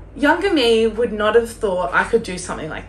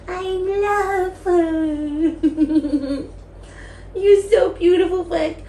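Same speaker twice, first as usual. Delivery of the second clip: high, adoring coo; doting pet talk; 8 semitones higher, much slower than usual. Real, not acted. Telling Flick she's beautiful, high doting coo. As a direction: gush over a beloved pet in a high voice.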